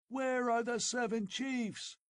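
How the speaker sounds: shrill high howl, stress on are. Acted. Old chief howling at Conan, brandishing his sword. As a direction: howl the demand. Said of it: "howled the old chief".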